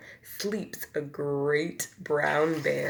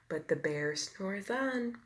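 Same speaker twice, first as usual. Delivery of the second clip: hushed refrain; 8 dB quieter than usual. A narrator's reading. Refrain, bear sleeping.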